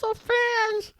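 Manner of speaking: very high, slow, wailing whale voice; whale swimming away. Acted. Whale calling a parting plug as he swims off, high wailing voice. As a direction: stretch each word into a high whale call. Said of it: "I heard on the phone as you swimming away, he went".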